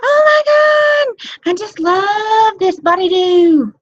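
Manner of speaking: overdone delighted shriek about Body Dew; gushing exclamation. Shown as a demonstration. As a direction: squeal over the product so everyone hears. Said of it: "you walk into that Starbucks and you say".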